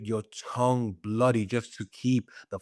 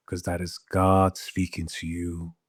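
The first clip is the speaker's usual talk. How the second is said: ordinary talk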